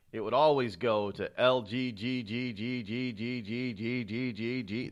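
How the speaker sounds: stuck repeating G like a scratched vinyl; skipping record imitation. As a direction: stutter the letter like a skipping record. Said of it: "They would skip back and over and over again".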